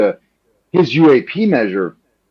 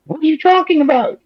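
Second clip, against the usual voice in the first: much higher mock disbelief; incredulous; faster than usual. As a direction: jump up in pitch, baffled. House caucus members reacting, incredulous.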